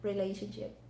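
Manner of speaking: ordinary talk, nothing marked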